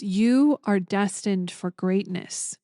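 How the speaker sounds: slow, deliberate pronouncement; slow prophecy. Retold or imitated. Airline clerk suddenly prophesying to traveller, slow and solemn. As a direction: lay each word down slowly like an oracle. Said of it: "she says".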